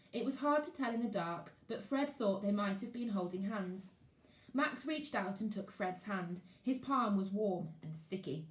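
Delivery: ordinary delivery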